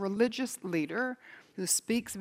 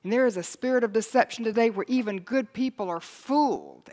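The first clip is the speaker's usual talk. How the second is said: normal talking voice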